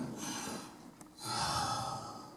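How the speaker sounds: long, breathy final sigh acted out; dying sigh. Son acting out his father's last breath. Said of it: "And he went like this".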